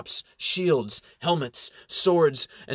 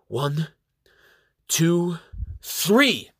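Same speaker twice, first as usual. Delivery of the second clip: counting out; 4 semitones higher, much slower than usual. A narrator's reading. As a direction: count down to the volley, building each number. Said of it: "She counts out".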